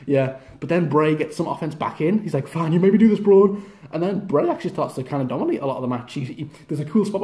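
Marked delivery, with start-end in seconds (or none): 2.5-3.6 much louder menacing declaration; mock threat. Bray warning Braun as he fights back, loud mock threat. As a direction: declare it like a villain striking back.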